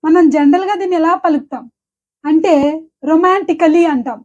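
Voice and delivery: ordinary talk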